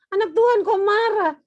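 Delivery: loud, high, incredulous reproach; mock scolding. Parent shaming an angry child, mock-scolding. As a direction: exaggerate the shocked telling-off.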